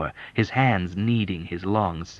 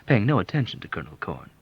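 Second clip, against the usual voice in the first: ordinary talk, nothing marked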